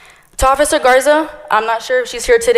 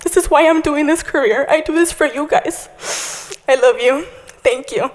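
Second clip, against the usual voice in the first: crying through words; 5 semitones higher than usual. Real, not acted. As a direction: voice breaking, pitched up high with tears. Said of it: "Sorry, I'm gonna cry".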